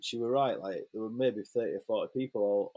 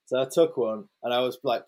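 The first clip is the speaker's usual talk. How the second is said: ordinary talk, nothing marked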